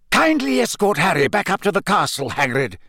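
high, sharp order; sharply polite command; Dumbledore, sharp. A narrator's reading. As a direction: give a curt, commanding instruction. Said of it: "said Dumbledore sharply".

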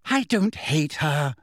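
slow, lofty denial; mildly haughty. A narrator's reading. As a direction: draw it out with superior dignity. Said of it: "said Hermione loftily".

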